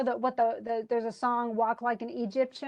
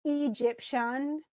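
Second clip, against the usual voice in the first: Egyptian slowly broken into EE-jip-SHUN; wrong stress; much slower than usual. Shown as a demonstration. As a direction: lean on first and third syllables of Egyptian, stretched out. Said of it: "that sounds weird".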